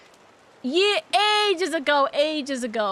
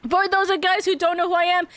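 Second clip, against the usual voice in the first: normal talking voice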